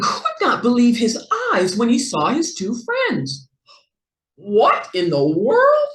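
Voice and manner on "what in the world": quacking duck. A narrator's reading. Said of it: "he quacked".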